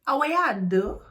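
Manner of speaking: dramatic patois with attitude; dramatic Jamaican patois. Shown as a demonstration. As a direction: hit it with attitude again. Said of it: "You hear the dramatization in that?".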